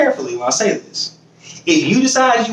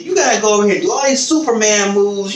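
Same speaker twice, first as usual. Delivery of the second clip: ordinary talk, nothing marked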